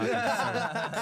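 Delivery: slow, laughing mock confession. Real, not acted. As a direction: drag it out through laughs. Joking banter, laughing.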